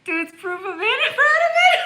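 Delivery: high, shrieking laugh through the words; can barely get it out for laughing; howling with laughter. Real, not acted. Laughing at a comment.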